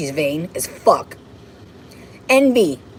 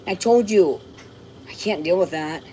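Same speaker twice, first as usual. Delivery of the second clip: ordinary delivery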